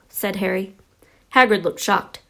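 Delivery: ordinary delivery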